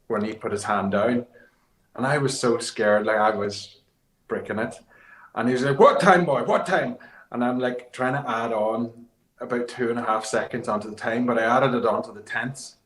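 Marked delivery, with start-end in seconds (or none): 5.8-7.0 loud, high Finnish bark; demanding Finnish driver. Retold or imitated. Driver pressing the co-driver for the time, loud and high in a Finnish accent.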